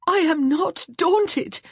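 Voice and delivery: high, loud, slow defiance; defiant cry. Acted. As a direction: hurl it back at him, high and slow. Wife defies husband, high and loud.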